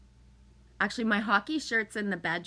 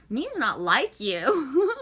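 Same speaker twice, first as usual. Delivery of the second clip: put-on laughing baby talk; 4 semitones higher, 6 dB louder, much slower than usual.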